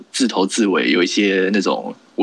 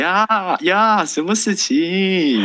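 Singaporean accent; 9 semitones higher, much slower than usual. Shown as a demonstration. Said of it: "我现在讲话都有一个怪腔怪调出现".

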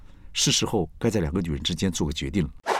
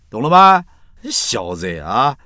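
teasing; 4 semitones higher, 8 dB louder, slower than usual. Retold or imitated. Teasing punchline to friend.

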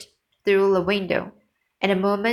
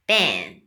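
gunshot bang; 11 semitones lower than usual. A narrator's reading. Shotgun fired at bird.

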